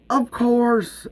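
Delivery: sudden high "of course"; startled. Acted. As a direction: caught off guard, cover fast. Val covering his misunderstanding.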